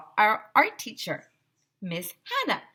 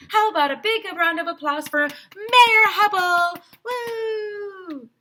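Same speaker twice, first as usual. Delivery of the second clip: high, loud cheering call; excited host; 12 semitones higher, 6 dB louder than usual. Acted. Vice principal hyping the crowd for the mayor, high and loud. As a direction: hype up the crowd.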